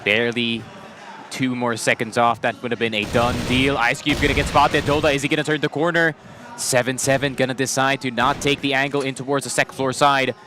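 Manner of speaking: live play-by-play; esports caster. Real, not acted. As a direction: keep calling the action. Play-by-play casting.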